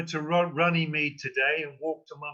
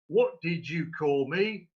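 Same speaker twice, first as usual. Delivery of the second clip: drawling thug; 2 semitones higher than usual. Acted. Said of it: "he finally drawls".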